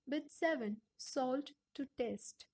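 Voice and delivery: ordinary delivery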